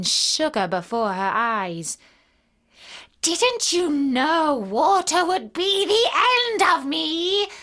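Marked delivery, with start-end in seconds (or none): 3.2-7.5 high, loud, drawn-out wail; doomed witch lamenting slowly; wailing, despairing witch. Acted. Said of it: "in a wailing, despairing voice".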